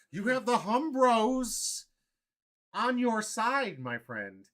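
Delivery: hammed-up loud declaration; rant mode. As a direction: proclaim it slowly like a rallying cry. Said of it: "rant mode".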